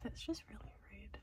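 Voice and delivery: soft hushed whisper. Real, not acted. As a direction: keep it to a breathy whisper. Whispering beside sleeping Jonathan.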